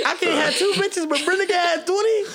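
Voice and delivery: very high, loud comic indignation; mock outrage. Comic complains about dating show double standard, mock outraged. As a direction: protest in a high, incredulous squeal.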